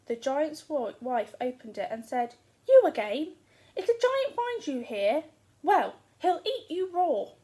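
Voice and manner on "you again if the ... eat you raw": high, loud startled cry; alarmed surprise. Acted. Giant's wife finding Jack at the door, high and alarmed. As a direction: warn him in a panic.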